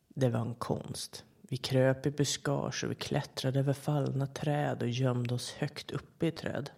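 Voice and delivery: plain reading voice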